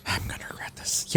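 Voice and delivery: unvoiced reluctant whisper. Real, not acted. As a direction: whisper it with a sigh of regret. GM aside, reluctant agreement.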